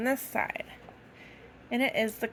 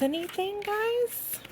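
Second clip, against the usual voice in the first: playful call; 12 semitones higher than usual. Acted. High playful call to kids.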